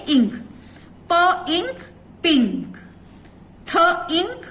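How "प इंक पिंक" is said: "प इंक" then "पिंक", blending the sounds. Shown as a demonstration. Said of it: "के को हम कैसे प्रोनाउंस करेंगे?". ("प इंक" अलग-अलग बोलकर फिर "पिंक" जोड़कर)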